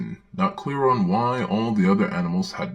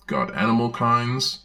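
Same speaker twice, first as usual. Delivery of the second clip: ordinary talk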